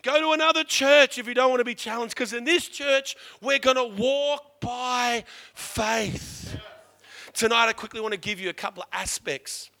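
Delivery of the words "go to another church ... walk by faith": loud, rising church warning; shouted challenge to a comfortable church; preached warning. Retold or imitated. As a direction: thunder it at the congregation. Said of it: "I keep saying to my people".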